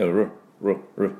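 ル as a short English R, tongue drawn back; Rの音. Shown as a demonstration. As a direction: separate r-coloured ル sounds. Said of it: "これがRの音です。". (ル を舌を引いたRで短く)

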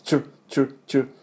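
チュ as a breathy "ch"-like tr cluster with no voice; チュ, a whispered tr burst repeated; tr sound drill. Shown as a demonstration. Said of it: "こういう音になります". (「チュ」をささやくように)

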